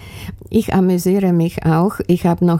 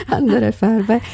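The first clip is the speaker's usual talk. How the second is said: real laughter in the words; laughing echo; 5 semitones higher than usual. Real, not acted. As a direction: repeat the joke back, still laughing. Laughs at the interviewer's joke.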